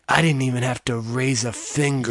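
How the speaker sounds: lightly voiced villain, low and soft; a hint of soft-spoken villainy; villain line. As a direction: drop low and quiet like a smug villain. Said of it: "a really villain line where he's like".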